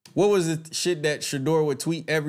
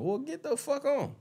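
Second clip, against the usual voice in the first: dismissive; 14 semitones higher, 4 dB quieter than usual. Real, not acted. Telling leaving viewers off.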